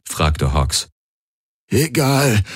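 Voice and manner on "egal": croaked. A narrator's reading. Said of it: "krächzte Galen".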